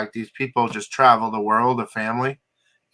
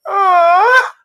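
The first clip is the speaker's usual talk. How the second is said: loud high moan in the ear, far higher; retelling a prank moan, high and loud; mock moan; 8 dB louder than usual. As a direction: prankster moaning in a stranger's ear. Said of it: "he goes".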